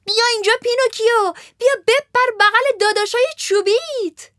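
high, fast, loud welcoming call; Harlequin calling. Acted. Harlequin calls Pinocchio onto the stage, high and eager. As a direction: beckon him up joyfully. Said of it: "هارلکوین داد زد".

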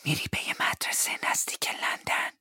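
breathy whisper through the keyhole. Acted. Peggotty whispers the news to David through the keyhole. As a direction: breathe it so no one hears. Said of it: "پگوتی نجوا کرد".

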